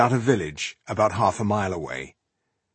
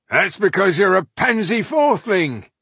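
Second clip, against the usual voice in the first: high, loud taunt from a hidden heckler; mocking; 10 semitones higher, 5 dB louder than usual. A narrator's reading. Unseen warrior jeering from under a helmet, mocking. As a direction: jeer at them in a raised, put-on voice. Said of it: "came the mocking response from under a helmet".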